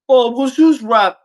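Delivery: high, loud mock objection; mocking imitation. Imitating a rap fan making excuses, high mocking voice.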